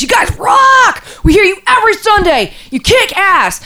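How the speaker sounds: high, loud mock drunken yell; mock yell of a drunk on the lawn; drunk fan shouting. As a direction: holler up at the windows, sloppy and happy. Retelling drunk guy shouting at windows.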